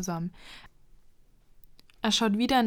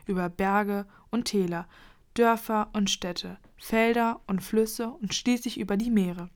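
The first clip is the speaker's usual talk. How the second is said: ordinary delivery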